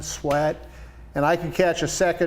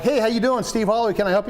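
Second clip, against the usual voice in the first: eager phone greeting; 6 semitones higher, faster than usual. Retold or imitated. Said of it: "I'm like".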